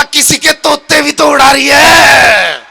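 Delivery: slow shout with a long-held ending; exasperated retort. Acted. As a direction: throw the wail back at full voice. Burdened man retorts to granny's wail. (झल्लाहट भरा लंबा, ऊँचा जवाब)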